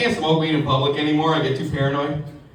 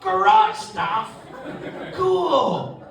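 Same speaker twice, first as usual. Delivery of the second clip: dismissive; 8 semitones higher, much slower than usual. Retold or imitated. Girlfriend dismissing stuff.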